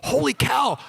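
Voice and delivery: high, stunned exclamation; astonished. Retold or imitated. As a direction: blurt it out in disbelief. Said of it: "we're all going".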